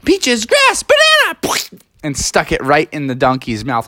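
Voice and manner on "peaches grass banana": very high triumphant call; high aunt call. Acted. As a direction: pop out and shout the snack. Aunt calls from window.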